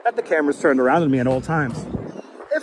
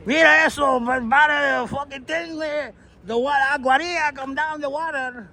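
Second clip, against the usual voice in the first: put-on high-pitched Puerto Rican Bronx accent; 8 semitones higher than usual. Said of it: "do I sound like the Puerto Rican from the Bronx?".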